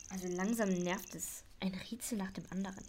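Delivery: annoyed whispered aside; grumbling whisper. Acted. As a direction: mutter it under your breath, fed up. Annoyed aside about more riddles. (genervt vor sich hin geflüstert)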